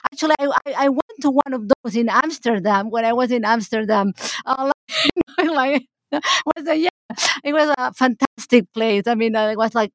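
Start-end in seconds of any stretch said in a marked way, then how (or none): 4.8-5.3 high laugh. Real, not acted. Laughing mid-story.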